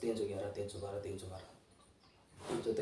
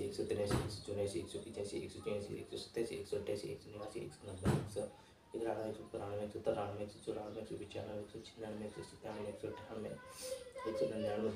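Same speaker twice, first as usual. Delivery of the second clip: speedy counting; 10 dB quieter than usual. Real, not acted. Challenger counting toward one lakh without stopping, speedy soft count. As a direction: count briskly and quietly, number running into number. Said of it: "थोड़ी speed तो रहेगी".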